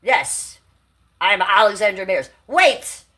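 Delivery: mock grand host lording it over everyone; Alexandra Mayers. Vlogger mocking a rival to her stream viewers, hammed-up impression. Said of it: "this is my Alexandra Mayers impression".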